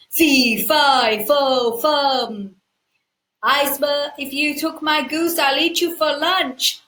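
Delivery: loud, menacing giant chant; booming giant. Acted. Giant warning the thief of his goose, loud and menacing. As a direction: boom out a storybook giant's threat.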